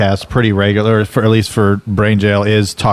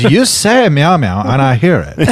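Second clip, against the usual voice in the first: put-on Armenian accent; 3 semitones higher than usual. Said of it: "he's got a thick Armenian accent".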